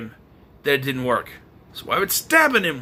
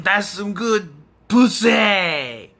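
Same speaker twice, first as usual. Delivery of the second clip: slow, sleazy villain leer; mock Killjoy; 3 semitones higher, 3 dB louder, much slower than usual. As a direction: drag it out like a leering killer clown. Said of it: "Killjoy pops out and says".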